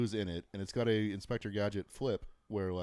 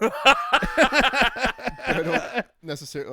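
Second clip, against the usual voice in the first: much higher and louder, slow and dissolving into laughter; words barely held together by laughter; laughing; 13 dB louder, much slower than usual. Real, not acted. Laughing at the mock-up.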